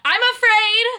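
shouting over headphones; yelling. Real, not acted. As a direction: yell it without hearing yourself. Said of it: "Why are you yelling?".

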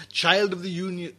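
ordinary delivery

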